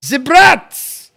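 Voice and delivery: hammed-up German accent, The brats as zee brats. Shown as a demonstration. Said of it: "I'll say it with the German accent".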